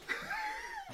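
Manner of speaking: squealing burst of laughter; high shrieking laugh. Real, not acted. Laughing outburst.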